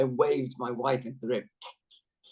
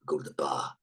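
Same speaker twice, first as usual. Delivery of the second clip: Tony; 10 semitones lower than usual. Retold or imitated. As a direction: imitate an elder actor recalling old exploits, very low. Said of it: "He's like".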